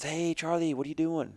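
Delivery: put-on high, bright greeting from an old buddy; friend calling. Roping buddy Mike phones Charlie out of the blue, cheerful high greeting. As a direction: call out to a friend you haven't heard from.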